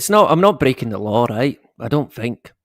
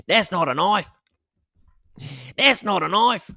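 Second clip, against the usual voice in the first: quoting a film line in a mock Australian accent, pitched higher; a playful Crocodile Dundee style Australian accent, the second line higher still; 6 semitones higher than usual. Greeting a viewer from Australia with a mock Australian accent. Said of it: "Australian accent".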